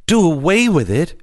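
put-on high, disbelieving outburst as a child; mild shriek. A narrator's reading. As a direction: read it as an upset girl's cry. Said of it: "shrieked Fern".

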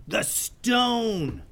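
high pirate villain voice, strained scream; Gruber's screamed demand. Acted. Pirate captain screaming at the ninjas: high villain voice. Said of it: "screamed Hans Gruber".

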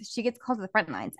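normal talking voice

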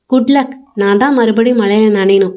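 ordinary delivery

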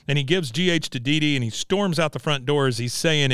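ordinary talk, nothing marked

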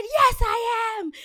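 slow, high, loud mock yell back at the clerk; defiant yell. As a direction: drag out each word in defiance. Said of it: "And I said".

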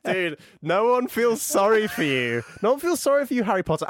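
high-pitched, emphatic mock scolding; exasperated rebuke. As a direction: hammer it home in exasperation. Acted exasperated rebuke of Harry.

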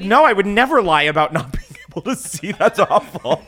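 high, loud, laughing protest; laughing mock denial. Mocking herself to the cohosts, laughing. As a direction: giggle through the fake denial. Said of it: "I was like".

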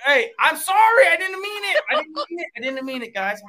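mock apology.

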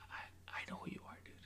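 very quiet, quick whisper; acted hushed confidence; conspiratorial whisper. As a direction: let them in on a secret. Acting Elon's secret knowledge.

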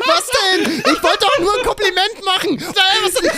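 very high mock-whiny outcry; hammed-up wail with laughter; wounded protest. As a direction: squeal in exasperated self-defence. Acts his wounded protest.

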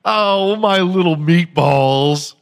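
loud, slow, doting little-pet-name voice; mock Jill Biden. Jill Biden addressing Italian Americans, doting and slow. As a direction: gush it like talking to a baby. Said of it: "and she's like".

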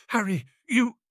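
high, broken-off horror; horrified. A narrator's reading. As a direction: break off aghast, pitch leaping. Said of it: "unable to continue".

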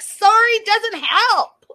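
loud, high, slow mock outburst; snapping back. Mocking the woman as she snaps at her date, hammed-up indignant outburst.

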